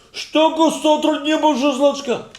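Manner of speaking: very loud, high-pitched tirade; mock Ukrainian yelling. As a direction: imitate a big man raising an uproar. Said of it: "Er nimmt zu machen Keulis". (מאכן קולות אויף אוקראיניש)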